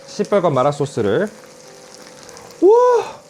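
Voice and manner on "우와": high, loud, amazed wow; amazed exclamation. Real, not acted. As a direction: gasp in awe at the fiery sauce. Sees the red mala sauce.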